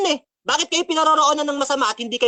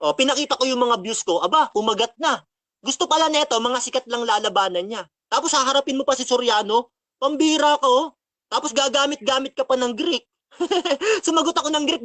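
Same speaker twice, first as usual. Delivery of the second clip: ordinary delivery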